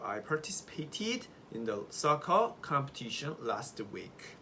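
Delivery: very slow, for repeating; slow model sentence. Shown as a demonstration. Teacher asks students to repeat after her, slowly. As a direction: read it slowly so students can follow. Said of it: "따라해보시죠".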